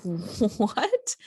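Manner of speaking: drawn-out, disbelieving what; incredulous. Retold or imitated. Shopper told she can't wheel the formula away herself, incredulous. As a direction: stretch it out in mock disbelief. Acting out disbelief at store employee.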